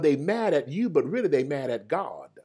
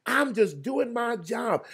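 high, slow plea to God; pleading lament; 9 semitones higher, slower than usual. Retold or imitated. Servant cries up to God, higher and slow. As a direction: stretch it out high like a hurt servant asking why.

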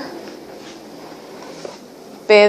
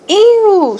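high, drawn-out squeal of disgust; 11 semitones higher, 4 dB louder than usual. A narrator's reading. Pedro threatened with a kiss from the worm, disgusted. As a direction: recoil with a high "ew".

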